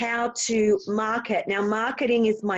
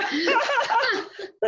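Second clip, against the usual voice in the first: high laugh; 13 semitones higher than usual. Real, not acted. Laughing at own story.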